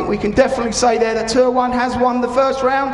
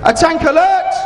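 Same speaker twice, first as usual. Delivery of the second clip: loud raised alarm call; shouted alert; 5 semitones higher, 5 dB louder than usual. Acted. Commander calls tank alert.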